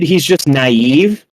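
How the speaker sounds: ordinary talk, nothing marked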